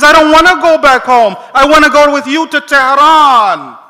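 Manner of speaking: put-on old man begging the leader to take him along, loud and high; pleading. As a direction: beg not to be sent home.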